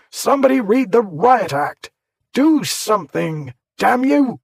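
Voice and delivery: high, loud desperate yelling; furious yell. A narrator's reading. Warbury trapped in the crowd, furious yelling. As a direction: rage at the crowd around him. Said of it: "could hear him above".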